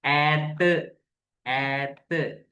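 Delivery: "ஏத்து" with a long open ae, "aaeth"; long ae example. Shown as a demonstration. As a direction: draw out the ae in "ஏத்து" slowly. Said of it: "நாலாவது ஏத்து". ("ஏத்து" நீண்ட ae ஒலியுடன்)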